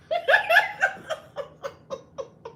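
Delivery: loud high laughing fit; high cackle. Real, not acted. Delighted at her friend's popularity, cackling laugh.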